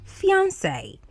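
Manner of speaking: fiancé said fee-on-SAY; pronunciation demonstration. Shown as a demonstration. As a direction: end fiancé clearly on "say". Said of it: "is also pronounced as".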